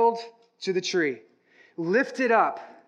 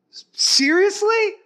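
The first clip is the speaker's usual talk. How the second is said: much higher, stammered slow disbelief; stunned disbelief; 11 semitones higher, 6 dB louder than usual. Acted. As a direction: gasp it out, unable to believe. James reacts to risen Jesus.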